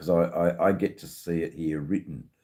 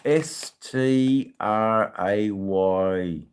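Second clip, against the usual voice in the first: slow, loud letter-by-letter spelling; big, stretched-out spelling lesson; spelled out emphatically; 5 semitones higher, 5 dB louder, much slower than usual. Shown as a demonstration. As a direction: call out each letter, drawn out and raised. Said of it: "And it's spelled".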